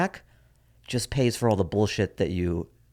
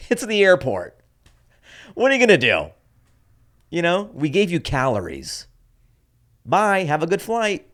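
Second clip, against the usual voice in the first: high, dismissive mock cashier voice; higher-pitched, shrugging fast-food worker impression; shrugging airport cashier; 8 semitones higher, 4 dB louder than usual. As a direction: toss it off like a bored airport clerk who couldn't care less. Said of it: "everyone just shrugs and goes".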